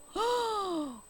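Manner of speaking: high, sudden gasp; sharp intake, high-pitched; startled gasp. Real, not acted. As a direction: gasp in surprise. Sudden reaction during the lesson.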